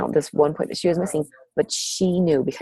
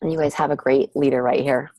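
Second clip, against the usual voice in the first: ordinary talk, nothing marked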